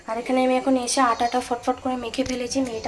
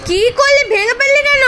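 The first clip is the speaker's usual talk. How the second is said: scolding shout; 14 dB louder than usual. Real, not acted. Scolding child who broke something.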